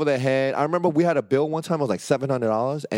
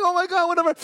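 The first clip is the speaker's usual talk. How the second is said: pitched-up panicked whatever; high flustered surrender; faster than usual. Retold or imitated. Grabbed friend pleading, high flustered. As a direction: give up anything to escape.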